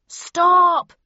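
loud, high yell; sharp commanding yell, held. A narrator's reading. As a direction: shout to halt a brawl. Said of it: "yelled the bellman".

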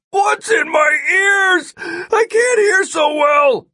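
slow, very high shout; bewildered, drawn-out yell. A narrator's reading. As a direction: holler as if half deaf. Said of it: "Ampix yelled".

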